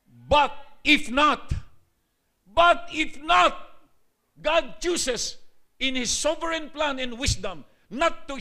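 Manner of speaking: loud, slow, high-pitched defiance before a king; defiant martyrs. Acted. Three young men refusing the king, defiant slow loud. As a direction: proclaim it slowly, like men facing the fire.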